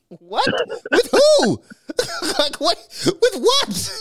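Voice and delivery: high squealing laugh-broken disbelief; shrill disbelief. Retold or imitated. Incredulous mock Janet.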